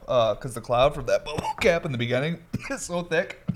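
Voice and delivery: words broken by coughs; coughing while talking. Real, not acted. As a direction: push through the coughing. Talking through a coughing fit.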